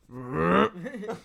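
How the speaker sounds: long mock retch; imitated retching. Friend panicking in the truck, imitated retching. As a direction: fake a drawn-out nervous heave. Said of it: "stuff that he would throw up about".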